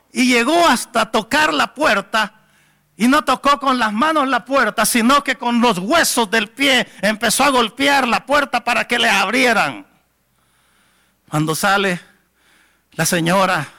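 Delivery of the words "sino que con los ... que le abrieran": normal talking voice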